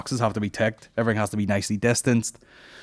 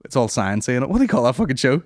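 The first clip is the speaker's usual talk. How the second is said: ordinary delivery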